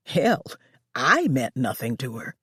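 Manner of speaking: plain reading voice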